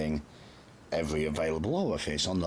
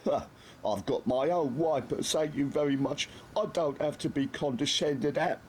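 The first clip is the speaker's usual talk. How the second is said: higher, huffy lad voice; sniffy; 11 semitones higher than usual. A narrator's reading. Nobby refuses the Major's handkerchief, sniffy. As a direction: sniff it, offended. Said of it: "sniffed Nobby".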